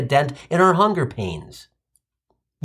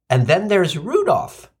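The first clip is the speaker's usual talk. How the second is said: ordinary talk, nothing marked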